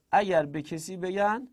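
ordinary delivery

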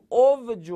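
"اوجه" as "ov-joh", the v sounded; vowelless vav demo. Shown as a demonstration. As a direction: make the vav in "اوجه" a consonant v. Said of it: "واو ساکنه".

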